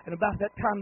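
usual speaking voice

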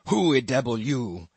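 a menacing cannibal demanding an answer; gruff cannibal voice. A narrator's reading. Queequeg confronting the narrator in the dark, gruff. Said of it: "he said at last".

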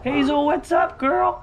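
high squeaky voice for the chicken; pet talk voice. Real, not acted. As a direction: coo up high at your pet hen. Greeting pet chicken Hazel.

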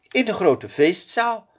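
high princess voice; bright young princess giving orders. A narrator's reading. Princess answers the lakei. (hoge prinsessenstem)

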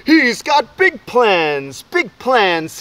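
loud, high cheer of big plans; fans shouting. Acted. Frenzied fans cheering the boy, shouting. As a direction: chant it like a stadium crowd.

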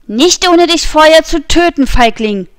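high and loud, raging; D'Artagnan screaming at the fleeing nobleman; screamed threat. A narrator's reading. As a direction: scream it while fighting off blows. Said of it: "schrie D'Artagnan".

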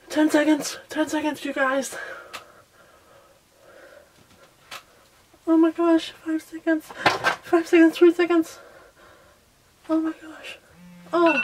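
high, loud panicked countdown; excited countdown. Real, not acted. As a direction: shout the seconds as time runs out. Countdown on timer.

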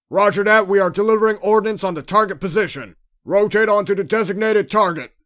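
forceful combat call. Acted. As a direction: shout confirmation over the battle. Crew firing on enemy in combat.

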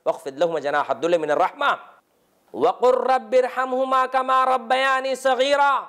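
chanted Arabic verse, rising and held long; Quranic recitation. Acted. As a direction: recite the verse in melodic tajweed. Quran recitation within sermon.

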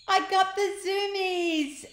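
high, loud and slow; delighted howl. Acted. Ned howling with delight as he flies. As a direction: howl it with glee. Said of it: "howled the boy in delight".

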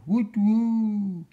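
high, long-drawn owl hoot. A narrator's reading. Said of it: "flew a hooting owl".

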